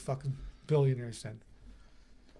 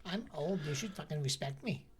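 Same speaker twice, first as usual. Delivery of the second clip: much softer, croaky old-lady mock; 11 dB quieter than usual. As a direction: mutter it like a cranky old woman. Mocking the old lady again.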